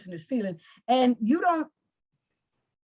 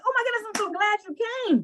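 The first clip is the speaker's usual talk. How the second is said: excited friend; 9 semitones higher, faster than usual. Pretend. Friend hearing about the Italy trip, gushing excitedly. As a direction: gush with delight at the news. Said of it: "You say".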